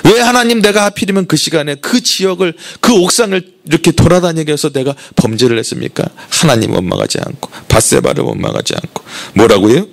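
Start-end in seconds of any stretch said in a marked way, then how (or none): 0.0-6.0 high-pitched, aggrieved protest at God; a pretend grievance voiced higher than the preacher's own; resentful complaint to God. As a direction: whine at God with a question that blames him. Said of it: "원망하지 않았어요".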